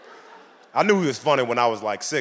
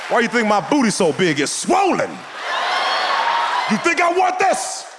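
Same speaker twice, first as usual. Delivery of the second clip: high, loud outraged complaint; indignant comic shout; 8 semitones higher, 3 dB louder than usual. Real, not acted. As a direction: yell it like the injured party. Punchline about whoopings.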